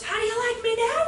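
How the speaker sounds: high-pitched cocky taunt, imitated; taunting boy. Ignored boy on the table to the class, taunting. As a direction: mimic a child showing off.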